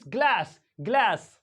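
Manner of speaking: GLASS as 'glaes', front 'ae' vowel; American A. Shown as a demonstration. Teacher drilling American English, 'ae' in GLASS. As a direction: say glass with the A of cat. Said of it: "In American English".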